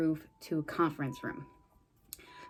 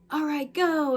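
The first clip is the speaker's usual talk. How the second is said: high, louder mock order; urgent command; 9 semitones higher, 6 dB louder than usual. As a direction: call out the signal to go. Voices team launching their plan.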